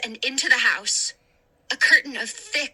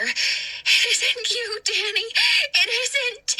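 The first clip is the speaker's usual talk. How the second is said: high, slow, fearful denial; pleading in fear; 9 semitones higher, slower than usual. Acted. Hannah pleading with the ghost Danny, high fearful pleading. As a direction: beg him to be himself again. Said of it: "tight with fear".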